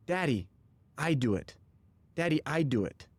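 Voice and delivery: lightly voiced little boy, short halting phrases; a hint of a toddler announcing himself to dad; imitated toddler. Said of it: "And he says".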